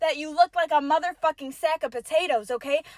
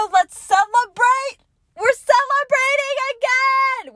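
high, loud festive cheer; excited celebrating; 10 semitones higher, 5 dB louder than usual. Real, not acted. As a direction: cheer it out like a party.

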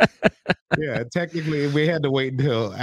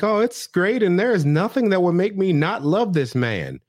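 much higher, sweet daughter voice; 10 semitones higher, 3 dB louder than usual. Retold or imitated. Daughter reassuring her dad, high and sweet. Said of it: "she was like".

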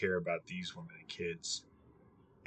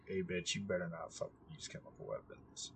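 mock hushed threat; 3 semitones lower, 14 dB quieter than usual. Voicing the US warning.

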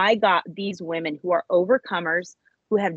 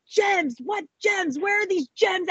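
much higher, frustrated yelling; exasperated high shout; 11 semitones higher, slower than usual. Retold or imitated. Woman yells in frustration at her dream. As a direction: demand to know where the gems are. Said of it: "I was so dissatisfied".

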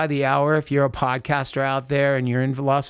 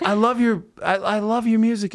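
higher-pitched retold gushing; imitated fan; 6 semitones higher than usual. Girl at House of Blues praising the singer, lightly imitated gushing. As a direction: lightly mimic an admirer, pitch lifted.

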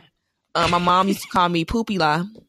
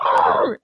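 put-on short frustrated growl of a mad little boy; angry kid growl; 5 dB louder than usual. Boy on the ride growls at his cousin, mock angry. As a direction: growl it like a sulking seven-year-old. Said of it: "huffing and puffing".

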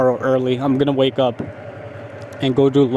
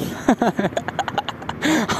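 soft unvoiced giggle, higher; quiet laugh; 6 semitones higher, 6 dB quieter than usual. Real, not acted. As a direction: breathe out a helpless little laugh. Laughs at own awkward answer.

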